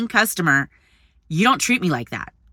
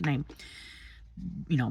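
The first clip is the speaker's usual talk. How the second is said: ordinary talk, nothing marked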